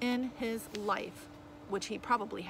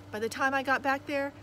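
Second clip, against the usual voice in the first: usual speaking voice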